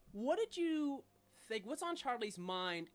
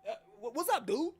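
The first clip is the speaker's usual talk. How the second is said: higher, goading tough-guy voice; second talking trash can; 6 semitones higher, 4 dB louder, faster than usual. Acted. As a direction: back up your pal, looking for trouble. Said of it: "You hear".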